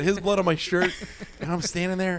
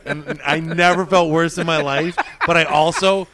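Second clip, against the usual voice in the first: laughing; 3 semitones higher, 9 dB louder than usual. Real, not acted. Laughing while telling the story.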